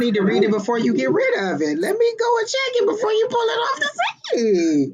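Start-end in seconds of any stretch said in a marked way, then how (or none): 1.8-4.9 high-pitched cry, dragging out the end; urgent plea. Real, not acted. Viewer begging to check a reading before it's pulled, urgent high cry. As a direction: call out urgently, voice shooting way up.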